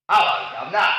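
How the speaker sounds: contained Cockney greeting; coach's patient Cockney demonstration; Cockney, sounds swallowed. Shown as a demonstration. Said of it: "we must swallow the sound".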